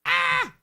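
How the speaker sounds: sudden high yelp; a quick startled squeal; short shrill "Ah!" burst. Acted.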